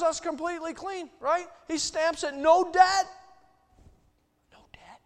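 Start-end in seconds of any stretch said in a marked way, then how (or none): 2.3-3.1 No debt, shouted high and loud like a stamp coming down; stamped proclamation. Acted. Stamping forgiveness, then whispered echo. 4.5-5.0 No debt, whispered with no voice and very soft. Acted. Whispered echo of the shout.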